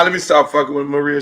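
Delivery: ordinary talk, nothing marked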